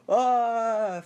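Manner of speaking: loud, high, drawn-out "ah"; mock scream. Reviewer acting out Bill hearing Mike's call, mock loud scream. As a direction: scream it as a hammed-up shock reaction. Character shocked by the phone call.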